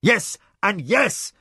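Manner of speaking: high, slow emphatic shout; the spymaster shouting back at the enraged master, slow and high. A narrator's reading. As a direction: shout him down. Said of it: "he shouted back".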